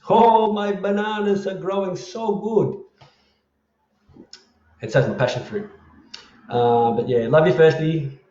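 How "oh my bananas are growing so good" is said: high, slow, drawn-out delight; exaggerated high-pitched boasting; hammed-up gushing. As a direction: gush proudly, stretching every word. Gushing over bananas after bad veggies.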